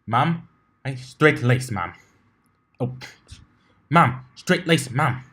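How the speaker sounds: slow, loud military bark; barked soldier reply. A narrator's reading. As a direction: snap out like a soldier at attention. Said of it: "saluted and barked out".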